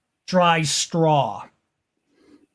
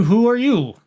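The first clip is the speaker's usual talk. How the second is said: a hint of a German accent, pitched up; not-quite-German accent; 5 semitones higher, 3 dB louder than usual. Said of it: "not quite a German accent".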